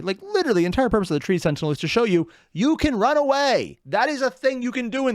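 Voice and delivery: high and loud, building to a cry; excited. Real, not acted. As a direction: pitch soars as excitement takes over. Excited rant.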